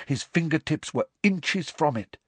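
ordinary delivery, nothing marked

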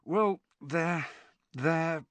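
falter, leaving it hanging; struggling for words. A narrator's reading. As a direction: grope for the word and stall. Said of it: "Ron struggled for words".

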